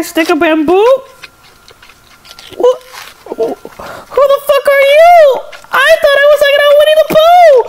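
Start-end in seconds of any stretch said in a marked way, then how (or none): 2.5-7.6 much higher, shocked put-on voice; high cartoon yelp; high startled character. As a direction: squeal it in alarm.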